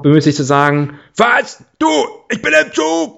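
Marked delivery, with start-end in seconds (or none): 1.2-3.2 shouting into phone. Retold or imitated. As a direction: yell like a bad phone line. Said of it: "so schreien".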